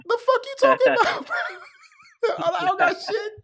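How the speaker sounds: very high, fast, laughing protest; outraged denial. Retold or imitated. Denying to the cellmate he smuggled anything, high laughing outrage.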